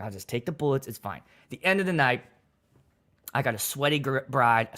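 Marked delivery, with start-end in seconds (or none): 0.0-1.2 resigned murmur. Retold or imitated. Resigned to insults.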